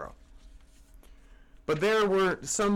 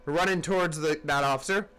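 plain reading voice

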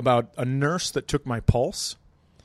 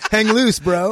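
loud, high bro call; mock surfer greeting; 8 semitones higher, 10 dB louder, slower than usual. Friend joking about the Shaka sign, loud mock surfer greeting. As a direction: holler it like a laid-back bro. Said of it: "yeah, like".

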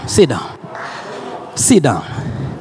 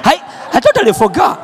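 normal talking voice

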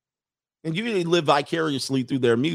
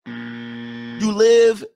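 drawn-out teasing; 4 semitones higher, 5 dB louder, much slower than usual. Acted.

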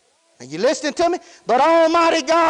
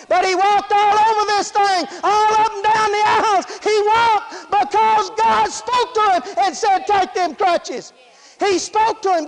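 rising preaching; 4 semitones higher than usual. Acted. Excited testimony of healing.

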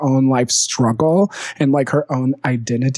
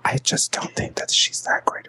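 secretive unvoiced whisper; 6 dB quieter than usual. Real, not acted. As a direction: hush it like a secret. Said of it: "I feel safer when I whisper".

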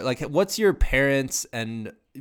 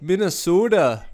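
mock Minnesota accent; 8 semitones higher, 5 dB louder than usual. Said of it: "Iowans mocking Minnesota pronunciation".

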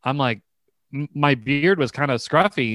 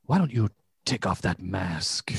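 low, hushed creepy barber; imitated creepy murmur; 6 semitones lower, 8 dB quieter than usual. Barber alone with a customer, leaning in, imitated creepy murmur. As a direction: lean in close and softly coax, imitating him. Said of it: "he leans in and he goes".

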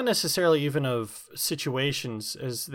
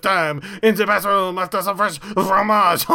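hammed-up French accent, loud and high; a silly French voice, played for laughs; mock French accent; 5 semitones higher, 6 dB louder than usual. Jokey French accent answering the host's setup.

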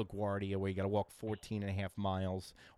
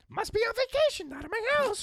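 very high, loud bird squawk; mocking Tony as a parakeet; 9 dB louder than usual. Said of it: "Tony's like a parakeet".

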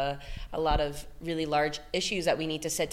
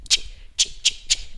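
forceful unvoiced ch bursts; hard ch sounds. Shown as a demonstration. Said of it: "if you go ch".

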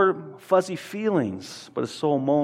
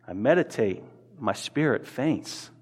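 fading faint; 5 semitones lower, 6 dB quieter than usual. Acted. Quiet fading end of psalm.

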